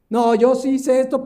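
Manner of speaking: hammed-up excuse; defensive outburst. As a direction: blurt it out like a guilty man justifying himself. Mocking a self-excusing sinner.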